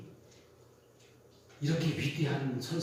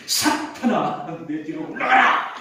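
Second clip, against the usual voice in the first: slow, louder command; stern rebuke; 11 dB louder, slower than usual. Acted. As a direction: drag out each word as a rebuke. Jesus rebukes Peter. (예수님이 베드로를 꾸짖으며 느리고 크게 호통치는 목소리)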